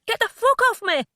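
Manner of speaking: high, loud yell; yelling at the man gripping her leg; furious yell. Acted. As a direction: shout him off. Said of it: "I said".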